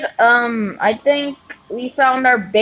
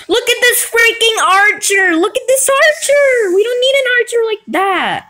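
high-pitched, agitated exclamation; high, indignant gamer rant; worked-up outcry; 10 semitones higher than usual. Real, not acted. Ranting at an enemy archer.

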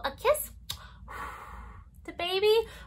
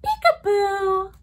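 higher, gentler peekaboo; lighter peekaboo; 6 semitones higher, 7 dB louder, slower than usual. Pretend. Second peekaboo, softer.

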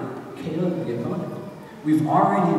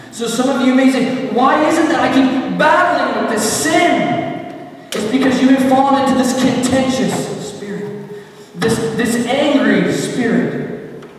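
impassioned preaching; 11 semitones higher, 7 dB louder than usual. Acted. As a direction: preach it hard with stretched, ringing words. Preaching at full force.

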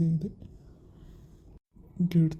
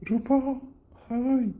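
a high, louder, eager hi; excited hi; 8 semitones higher, 4 dB louder, much slower than usual. Acted. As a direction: brighten up and call hi to her. Greeting her at the window.

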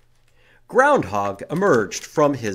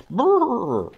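shivering brrr; 10 semitones higher, 5 dB louder than usual. Shown as a demonstration. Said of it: "can you shiver?".

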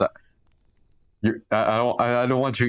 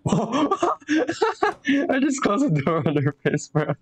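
high, slow, through laughter; laughing; 12 semitones higher, much slower than usual. Real, not acted. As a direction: giggle the words out high. Laughing at his own move.

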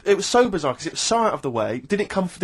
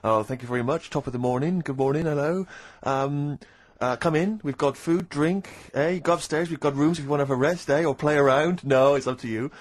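French host's lower-pitched fake English accent; put-on English accent; 4 semitones lower than usual. French restaurant man welcoming coach passengers, in put-on English accent. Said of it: "he's putting on a kind of English accent".

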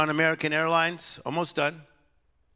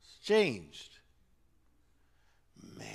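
ordinary talk, nothing marked

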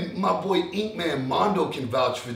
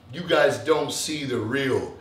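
ordinary delivery

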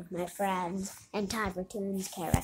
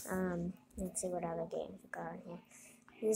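whispering; 8 dB quieter than usual. Real, not acted. Unvoiced aside while browsing games.

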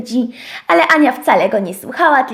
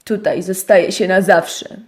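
ponury, niższy szczur; mów nisko jak groźny szczur; niski głos szczura; 6 semitones lower than usual. Acted. (niski, ponury głos szczura)

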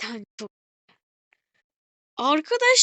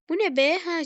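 ordinary talk

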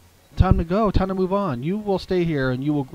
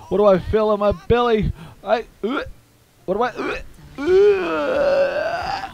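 higher, halting, rising to a cry; startled confusion; 6 semitones higher, slower than usual. Retold or imitated. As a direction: stammer in shock. She said.

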